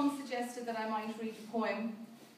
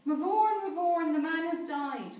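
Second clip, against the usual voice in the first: dismayed cry; 6 semitones higher, 6 dB louder than usual. Acted. Priest wakes to find death.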